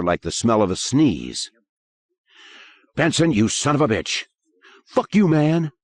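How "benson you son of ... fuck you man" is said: angry shouting. Acted. Fight in classroom.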